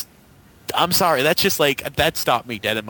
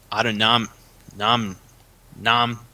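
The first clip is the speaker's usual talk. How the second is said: tongue-tied; slower than usual. Real, not acted. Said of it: "I can't even speak".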